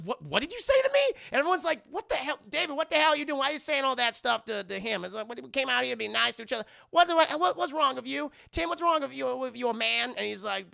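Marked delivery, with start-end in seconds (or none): 0.0-1.0 high, loud acted indignation; mock outraged challenge. Acting insulted father's challenge. 1.9-5.0 high, raised mock outrage; acted shocked protest. Acting everyone shocked at Davey. 5.3-10.1 high, loud flustered scolding; mock-exasperated questions, pitched up; acted appalled protest. Acting everyone protesting the insults.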